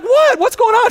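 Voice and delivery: very high, loud startled cry; shocked alarm. Retold or imitated. New dad hearing the baby's coming, shocked high cry. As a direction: panic in disbelief. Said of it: "I'm like".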